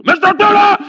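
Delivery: sudden high, loud yell of the name; wake-up yell. Acted. Camel to his sleeping owner, yelling. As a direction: yell to jolt a sleeper awake.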